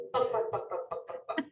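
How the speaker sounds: rapid, soft popping gunfire; imitated gunshots. Shown as a demonstration. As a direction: fire off short popping bursts. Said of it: "imitate the sound of gunshots".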